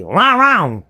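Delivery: slow, swooping imitated bark; barking Texan drawl. Texan passenger repeats for the steward, slow mock bark. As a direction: drag out the garbled order like a mock bark. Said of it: "il m'a aboyé dessus".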